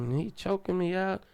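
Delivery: ordinary delivery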